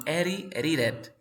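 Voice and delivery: "Eri, edited" slowly, D and T as Spanish R; tapped like R. Shown as a demonstration. Teacher modelling vowel-to-vowel sounds, "Eri, edited" with taps. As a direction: flap the middle consonants in "Eri, edited". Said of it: "suena como R".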